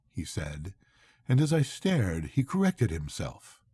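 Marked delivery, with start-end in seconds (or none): none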